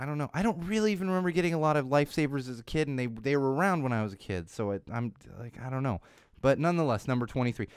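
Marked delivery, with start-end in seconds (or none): none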